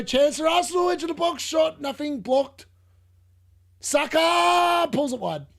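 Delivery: high, loud excited shouting; real live excitement; excited commentary. Real, not acted. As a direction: call the chance as it happens. Live match reaction.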